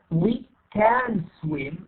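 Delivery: very slow, CAN with its full vowel; full form. Shown as a demonstration. Teacher shows what not to say, slow full CAN. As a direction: stretch each word, CAN said whole. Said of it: "We don't say".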